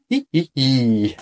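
ordinary delivery, nothing marked

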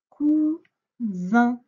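"cousin" with a voiced z in the middle, kuzin; "cousin" said kuzin, not coussin. Shown as a demonstration. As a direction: make the s in "cousin" buzz like z. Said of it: "et pas un coussin".

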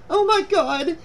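high-pitched horrified cry. A narrator's reading. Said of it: "she cried".

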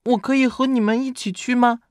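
put-on high-pitched little kid voice; tiny high voice of a younger brother. A narrator's reading. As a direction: ask hopefully as a small child, very high. Little brother pleading to come along.

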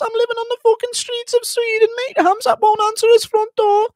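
put-on high-pitched squeaky Liverpool accent, whining; Darren Till impression. Streamer imitating a dumped fighter, squeaky Scouse whine. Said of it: "Darren Till cut from the U F C after losses impression".

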